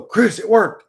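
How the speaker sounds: quick excited shout, acted out; calling out. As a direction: holler the good news to a friend. Quoted shout-back to a friend.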